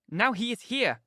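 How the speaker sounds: urgent cry of warning; exclaiming. A narrator's reading. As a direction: announce it in alarm, pitch lifted. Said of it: "I exclaimed".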